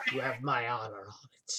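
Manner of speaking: soft unvoiced whisper; whispered aside. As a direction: murmur it privately.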